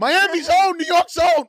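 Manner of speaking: big, loud, fast hype-man announcement; loud, fast hype-man intro; hype host intro. Acted. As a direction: shout the guest in like a hype man.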